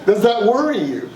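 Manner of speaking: ordinary delivery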